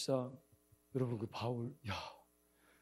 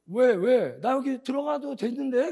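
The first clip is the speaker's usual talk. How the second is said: loud, high-pitched acted protest; raised voice, pleading to know why; indignant protest; 10 semitones higher, 10 dB louder, slower than usual. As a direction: argue back loudly at the guards.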